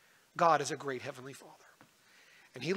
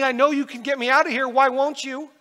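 put-on higher, louder child's yell; yelling daughter; 10 semitones higher, 11 dB louder than usual. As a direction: shout the demand at Dad. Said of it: "Evangeline's yelling at me".

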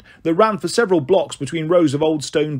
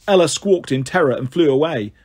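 ordinary delivery, nothing marked

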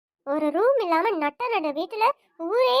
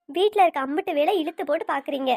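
ordinary talk, nothing marked

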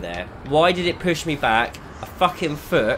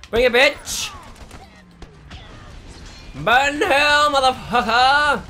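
high, loud taunting yell; furious yell; 13 semitones higher, 4 dB louder, slower than usual. Real, not acted. Gamer taunting the boss in a fight, furious yell. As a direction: snarl the taunt out at full volume. Player yelling at a game boss.

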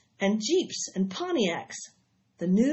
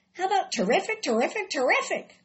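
eager goose, much higher; 9 semitones higher than usual. Acted. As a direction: pitch it up like a bright goose. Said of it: "asked the goose".

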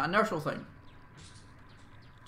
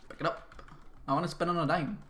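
usual speaking voice

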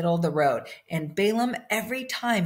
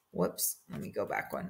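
hushed aside; 10 semitones lower, 11 dB quieter, faster than usual. Real, not acted. Teacher's aside while flipping pages.

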